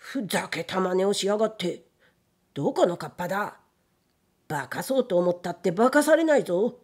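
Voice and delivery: frightened gatekeeper talking tough; trembling bravado. A narrator's reading. Rattled gatekeeper challenging the unseen kappa, trembling bravado. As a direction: bluster bravely with a tremble in the voice. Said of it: "セリフは勇ましいが震え声で".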